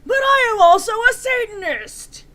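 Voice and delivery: overdone furious boast; angry declaration. A narrator's reading. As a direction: announce it defiantly. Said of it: "Hagrid paused angrily".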